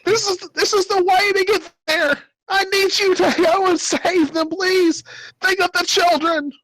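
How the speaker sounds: frantic pleading in very high falsetto; panicked old man. Acted. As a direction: beg desperately in a squeaky put-on voice. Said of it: "in a panic state".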